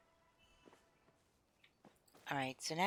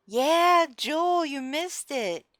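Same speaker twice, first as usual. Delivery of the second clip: excited; 9 semitones higher, 7 dB louder, much slower than usual. Real, not acted. Excitedly greeting the troller.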